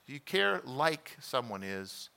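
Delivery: ordinary talk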